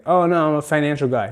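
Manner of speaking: ordinary talk, nothing marked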